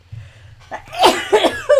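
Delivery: loud high sneeze burst; big sneeze. Real, not acted. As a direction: let a huge sneeze rip.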